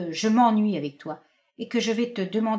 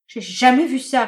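outraged repetition; 4 semitones higher, 8 dB louder than usual. Acted. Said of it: "répétait-elle".

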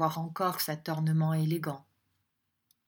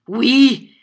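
high, booming shout; thunderous; 5 semitones higher, 15 dB louder than usual. A narrator's reading. Young teacher defying the schoolmaster, thunderous. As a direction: thunder out the single word. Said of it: "d'une voix de tonnerre".

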